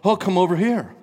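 high, loud calling out; another competing voice. Acted. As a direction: beckon urgently from across the way. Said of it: "then the one".

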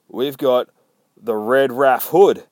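slow, loud announcement with pauses; slowed-down reveal. Acted. Reviewer rewinds to reveal the character, slow and emphatic. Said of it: "Slow it down and reverse it".

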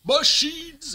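"machines" yelled with the last syllable stretched; Lawrence Fishburne's yelled pronunciation. Retold or imitated. Podcaster mimicking a film actor's line, "machines" yelled long. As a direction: call out "machines", loud and drawn out. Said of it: "it's his pronunciation".

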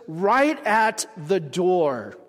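each word of "right at the door" drawn out slowly; slow emphasis. Shown as a demonstration. Preacher stressing to the congregation, slow "right at the door". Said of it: "it repeats this emphasis".

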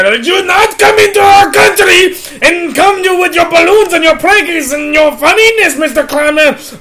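high, stiff mock German accent. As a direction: scold in a hammy German accent. Imitating Germans within impression.

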